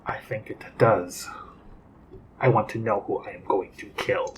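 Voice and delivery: low, whispered threat; menacing whisper. Acted. Character threatens jailer.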